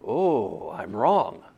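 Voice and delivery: low, quiet admission of being wrong; mock crestfallen, dropping low and soft; deflated realization. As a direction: sink into a mildly deflated, drawn-out "oh". Acting out discovering one is wrong.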